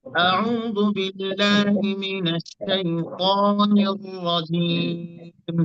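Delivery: slow chanted আউযু বিল্লাহি, রাজিম drawn out long; Arabic recitation, very slow; Quran recitation. Shown as a demonstration. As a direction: recite it slowly like tajweed. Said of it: "তিনটার উচ্চারণ same same হবে".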